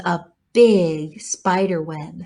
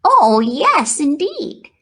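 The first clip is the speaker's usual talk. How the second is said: higher, glad piglet; delighted piglet; 8 semitones higher, 4 dB louder, slower than usual. Acted. Wilbur finally seeing Charlotte, delighted. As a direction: answer with relief.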